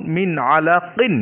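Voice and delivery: মিন আলাকিন said very slowly, with a clear ইন at the end of আলাকিন; slow মিন আলাকিন that shows the জের ending; kasra ending demo. Shown as a demonstration. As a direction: stretch মিন আলাকিন and sound out the final কিন. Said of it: "এটার শেষের উচ্চারণটা লক্ষ্য করুন".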